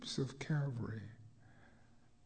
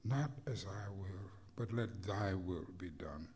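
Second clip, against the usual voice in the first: hushed surrender; 9 semitones lower, 7 dB quieter than usual. Acted. Repeated line, hushed take.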